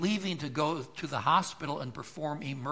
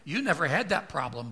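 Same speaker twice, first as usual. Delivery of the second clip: usual speaking voice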